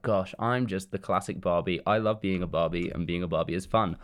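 soft, low imitation of Barbie; lightly voiced Barbie. Retelling Barbie's opening scene, lightly voiced. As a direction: quietly play a contented doll. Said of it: "we have Barbie, and she's like".

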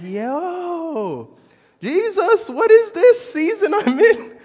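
high, loud, laughing plea to Jesus; crying out to Jesus in disbelief, voice cracking up with laughter; exasperated cry. Retold or imitated. Retelling how he cried out to Jesus, high laughing exasperation.

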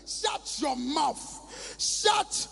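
slow, loud shout of shut your mouth; angry rebuke. Acted. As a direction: drag each word out and bark it down. Rebuking a discouraging person.

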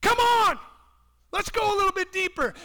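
loud, high, rousing call; fervent preaching shout; inviting Jesus. Pretend. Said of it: "you hear Jesus say".

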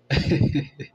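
loud, high burst of laughter; amused laugh. Real, not acted. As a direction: laugh out loud at your own joke. Laughing at own joke.

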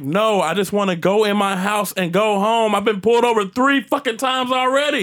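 high-pitched rant building to a yell; angry pleading. Retold or imitated. Driver at home yells at cop, building high angry rant. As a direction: build from aggrieved protest to loud outburst.

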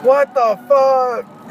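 slow stunned disbelief; shocked. Real, not acted. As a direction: drag it out in shock. Shocked at the pregnancy news.

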